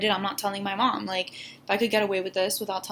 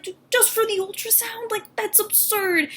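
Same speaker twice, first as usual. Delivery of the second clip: incredulous outrage; 12 semitones higher than usual. Retold or imitated. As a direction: protest like it's unbelievable. Outraged at ultrasound price.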